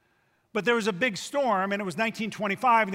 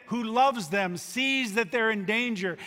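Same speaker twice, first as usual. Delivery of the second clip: ordinary talk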